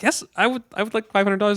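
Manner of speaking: put-on high-pitched naive kid saying yes; eager reply. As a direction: imitate an eager sheltered boy. Retold eager reply to hawker.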